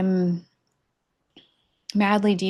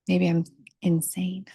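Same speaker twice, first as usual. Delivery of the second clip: a playful whispered aside; conspiratorial whisper to the viewer. Real, not acted. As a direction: lean in and whisper it like a secret. Playful aside about sanity.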